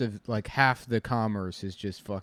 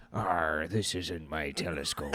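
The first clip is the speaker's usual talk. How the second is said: lightly voiced pirate, pitched down; a low, half-laughed pirate growl of complaint; 5 semitones lower, 4 dB quieter, slower than usual. Comedian playing a pirate with an eye injury, mock pirate voice. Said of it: "like a pirate".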